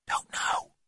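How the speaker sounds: very soft, breath-only reply; hushed boyish whisper. A narrator's reading. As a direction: whisper back from hiding. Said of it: "whispered Jeremy James".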